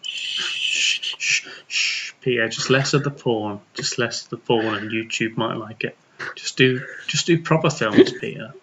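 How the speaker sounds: soft, low hushing; shush, then coax him softly; mock soothing. Consoling Peter about his films, softly mock soothing. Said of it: "hug him and just go".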